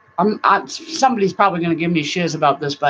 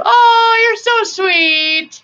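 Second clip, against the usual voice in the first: gushing thanks; 7 dB louder, much slower than usual. Real, not acted. Thanking a viewer in chat.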